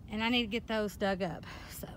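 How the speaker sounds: breathy unvoiced whisper. Real, not acted. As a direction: whisper it to yourself. Whispered aside in the garden.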